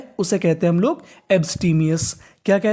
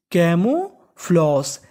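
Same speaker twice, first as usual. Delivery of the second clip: slow drawn-out; 2 semitones lower, much slower than usual. Shown as a demonstration.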